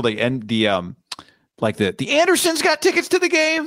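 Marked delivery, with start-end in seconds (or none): 2.1-3.7 high, sing-song ad announcer voice; announcer impression. Retelling the commercial to his cohost, in a high announcer voice. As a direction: ham up the old ticket ad voice. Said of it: "He voiced that commercial".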